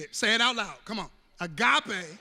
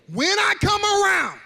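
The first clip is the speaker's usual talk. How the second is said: loud, high fervent preaching; loud building sermon; preaching shout; 4 semitones higher, 8 dB louder than usual. Real, not acted. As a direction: preach it out at full force.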